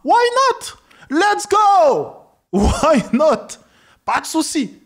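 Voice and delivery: very high, squealed mock excitement; mock enthusiasm. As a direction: parody a ditzy cheerleader. Mocking a naive girl's choice.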